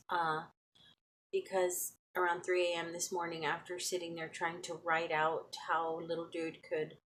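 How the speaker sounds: soft, worn-out evening voice; exhausted quiet talk. Real, not acted. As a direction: tell it drained, near the end of the day. Tired, low evening talk.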